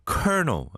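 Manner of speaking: colonel said as /knol/, like kernel; "Colonel" sounds like kernel, no L; 콜로넬 아닌 커널처럼; colonel said right. Shown as a demonstration. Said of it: "스펠링하고 조금 많이 다른 단어들".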